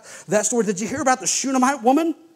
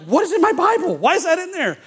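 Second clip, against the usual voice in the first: higher-pitched baffled questioning; incredulous, high-pitched double question; mock disbelief; 9 semitones higher than usual. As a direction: act out being baffled by what you just read. Acting out a baffled Bible reader.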